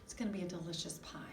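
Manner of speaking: quick hushed whisper. Acted. Teacher whispering a secret to the children.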